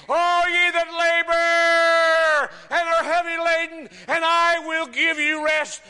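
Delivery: high, loud, driving sermon shout; impassioned, high-pitched pulpit cry; full-force preaching. Acted. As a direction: preach it at the top of your voice, stretching the big words.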